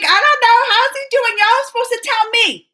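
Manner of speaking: high, loud playful protest; mock indignation. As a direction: scold the viewers in fun. Playfully scolding viewers about a boyfriend.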